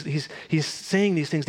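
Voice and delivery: usual speaking voice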